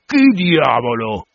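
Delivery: slow, drawn-out cannibal challenge; lightly voiced guttural savage, drawing the words out. A narrator's reading. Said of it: "le sue risposte gutturali".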